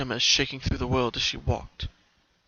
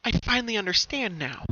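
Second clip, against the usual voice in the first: lightly raised pony voice, gentle; Pinkie, softly; 8 semitones higher than usual. Acted. Pinkie to the slender pony, gently, in her pony voice. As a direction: say it like a calm realization. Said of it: "Pinkie said softly".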